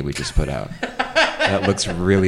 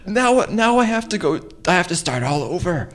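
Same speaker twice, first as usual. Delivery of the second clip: flustered whine; 10 semitones higher than usual. Acted. Flustered whining after failure.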